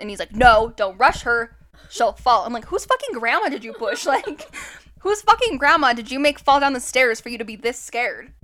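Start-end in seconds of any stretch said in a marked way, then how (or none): 0.4-2.4 louder and slow, hammering the point; slow it down like talking to a child; mock stern boyfriend, drawn out; insistent warning. Boyfriend insists third time. 2.7-8.3 put-on louder, disbelieving jab at the boyfriend; mock outrage at his caution; incredulous retort.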